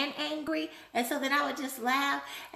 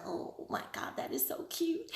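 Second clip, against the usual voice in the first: breathy hushed gush over something cute; whispered delight; 6 semitones higher, 8 dB quieter than usual. Retold or imitated. Vlogger imitating buyers seeing a cute lip balm, whispered delight. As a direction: whisper it like you just saw something adorable. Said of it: "they're gonna look at it and go".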